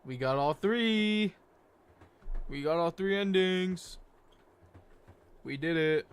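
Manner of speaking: slow, loud cheer at finishing; real triumph. Real, not acted. Streamer celebrates all three endings with viewers, triumphant.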